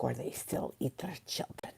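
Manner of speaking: hushed, eerie whisper; spooky whisper. Retold or imitated. Kevin telling about a part of Ireland, in a spooky whisper. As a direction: whisper it like a scary tale. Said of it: "He's like".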